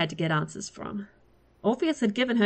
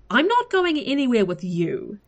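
defiant refusal; 6 semitones higher, 4 dB louder than usual. A narrator's reading. Refusing the hunter.

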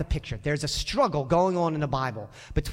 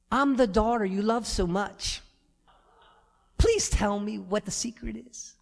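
sweet pleading daughter. Retold or imitated. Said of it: "Imagine Madison coming in the room".

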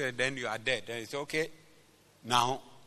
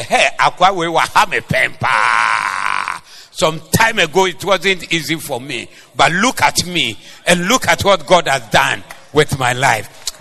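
mock testimony shout; 10 dB louder than usual. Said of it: "Wobɛkae sɛ".

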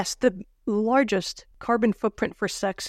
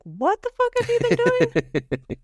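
high, drawn-out comic disbelief; mock incredulous; 14 semitones higher, slower than usual. Security advisor to the president after a briefing, mock incredulous. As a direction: stretch it out in hammed-up disbelief.

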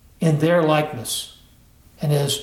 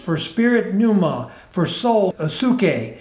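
normal talking voice

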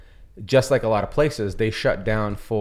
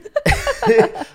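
laugh; 6 dB louder than usual. Real, not acted. Laughing at comments.

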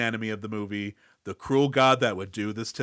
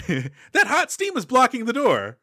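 a lightly put-on game guide, higher and slower; mock game helper; 10 semitones higher, 5 dB louder, slower than usual. Robin explaining the blocked door like a game guide, higher and slower. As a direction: state the obvious like a game character, slower and higher. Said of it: "the video game character that comes and explains".

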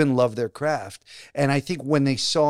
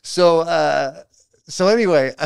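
ordinary delivery

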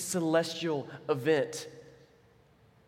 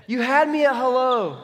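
higher and louder, dramatic; big movie line; 6 semitones higher, 7 dB louder than usual. Retold or imitated. Preacher hamming up the movie quote for the congregation, loud. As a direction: belt out the famous movie line.

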